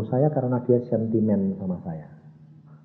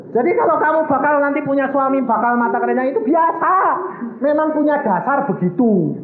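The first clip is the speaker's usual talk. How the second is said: high, raised preacher's voice; emphatic high-pitched exhortation; full-force preaching; 12 semitones higher, 3 dB louder than usual. Real, not acted. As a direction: drive it home to the whole congregation.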